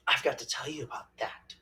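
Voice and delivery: whispered aside. Real, not acted. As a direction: lean in and whisper it. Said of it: "unvoiced aside".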